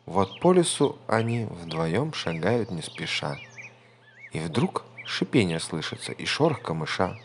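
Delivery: ordinary delivery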